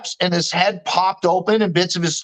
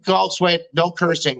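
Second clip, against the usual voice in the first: ordinary delivery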